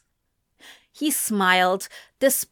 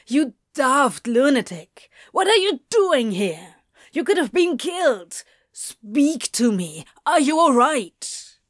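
worried, higher-pitched telling-off; frantic scolding; 3 semitones higher than usual. A narrator's reading. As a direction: scold them out of sheer alarm. Frantic demon scolds and shakes angel.

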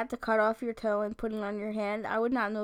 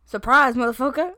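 loud meme quote; 10 dB louder than usual. Retold or imitated. Quoting a meme.

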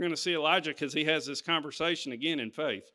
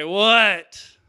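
sudden loud, higher cry of what; booming call; 5 semitones higher, 12 dB louder than usual. Acted. God calling out to the one hiding, booming. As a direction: hit the word like a call from heaven.

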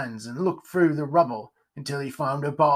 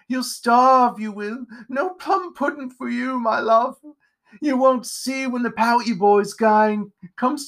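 possessed, cackling witch mother; high, menacing mock-motherly witch; witchy high-pitched voice; 9 semitones higher, 4 dB louder than usual. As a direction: taunt in a high witch voice not your own. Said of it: "in a witchy, high-pitched voice that was not his own".

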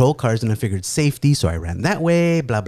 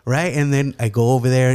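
ordinary delivery, nothing marked

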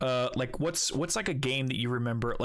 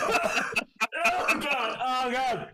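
high laughing oh God; 6 dB louder, much slower than usual. Real, not acted. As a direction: gasp it out through laughter. Laughing after spit-take.

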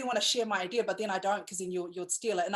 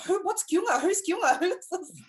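high, puzzled questions; confused, puzzled; 9 semitones higher, 4 dB louder than usual. Retold or imitated. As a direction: ask like you're baffled. Viewers' reaction quoted.

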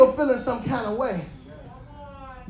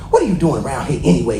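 much lower, quick gruff brother's demand; demanding Eliab; 8 semitones lower, faster than usual. Acted. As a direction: bark it down like a jealous older brother. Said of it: "he demanded".

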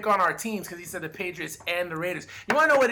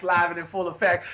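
usual speaking voice

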